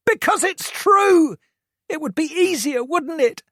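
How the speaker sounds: high-pitched, loud furious rant; angry teen rant. A narrator's reading. Hurt son lists Toby's abuse to mum, furious and high. Josh's voice.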